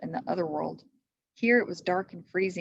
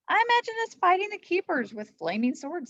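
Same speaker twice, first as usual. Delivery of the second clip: Annie voice; 12 semitones higher, 4 dB louder than usual. A narrator's reading. Annie's voice, much higher.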